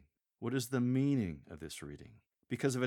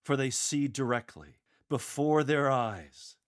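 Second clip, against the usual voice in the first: louder and higher, "before their eyes" slowed; smiling announcement; 4 semitones higher, 6 dB louder than usual. Shown as a demonstration. Lector announcing the eyewitness news, smiling and loud. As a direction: beam and announce it. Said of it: "Continue smiling and announce".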